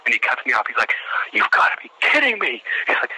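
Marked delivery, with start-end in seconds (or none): 1.3-2.6 high, exasperated drill-instructor cry; mock exasperation. Sergeant cutting off the candidate's greeting, imitated exasperation. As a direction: act out a disbelieving sergeant.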